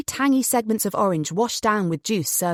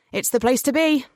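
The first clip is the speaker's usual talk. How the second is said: ordinary delivery, nothing marked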